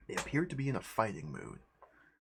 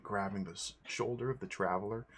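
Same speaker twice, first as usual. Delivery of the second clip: ordinary delivery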